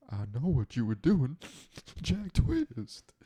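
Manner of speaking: loud, high teasing call-out; playful shout of gotcha; mock accusation. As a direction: accuse him loudly like you caught him. Teasing accusation, Brokeback joke.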